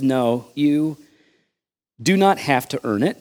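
ordinary talk, nothing marked

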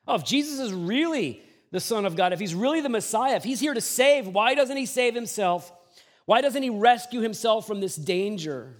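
loud, high, jeering questions; mocking taunt. Rulers jeering at Jesus on the cross, loud and high and mocking. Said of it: "they scoffed at him".

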